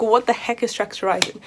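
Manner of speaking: normal talking voice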